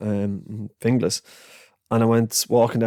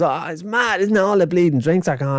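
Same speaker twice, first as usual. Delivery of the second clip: put-on higher, cheeky young Dublin kid voice; 7 semitones higher than usual. Narrator quotes the cheeky Dublin boy, higher and chirpy. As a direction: play a chatty seven-year-old. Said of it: "he just goes".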